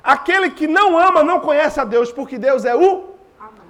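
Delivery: preaching. Acted. Preaching Scripture with force.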